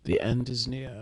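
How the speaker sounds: slow, ominous doomsday prophet; slow, ominous 'The end is near'. Pretend. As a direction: intone it like a street-corner prophet of doom. Ominous end-times proclamation.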